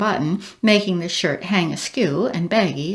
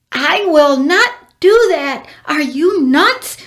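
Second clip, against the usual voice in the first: high, loud, slow outburst; shouting; 7 semitones higher, 8 dB louder, slower than usual. A narrator's reading. Embarrassed girl shouting at the bird, loud and high. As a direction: yell it out in horror. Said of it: "she shouted".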